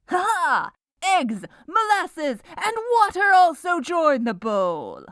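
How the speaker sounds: high, loud, gleeful announcement; triumphant. Acted. Baker adding eggs and molasses, triumphant. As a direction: proclaim the ingredients like a victory.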